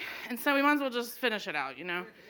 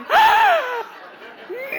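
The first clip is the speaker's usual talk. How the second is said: sharp, very high gasp that breaks into a laugh; shocked gasp; 8 dB louder than usual. Retold or imitated. Fan realizing the crowd is coming, high laughing gasp. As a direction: suck in air in laughing alarm.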